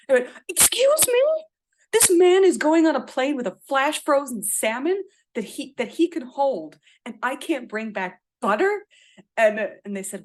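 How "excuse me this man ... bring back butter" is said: high, loud, incredulous protest; indignant outrage. Retold or imitated. To the customs agent about the salmon, outraged and indignant. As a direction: throw the injustice back at them, shrill with disbelief.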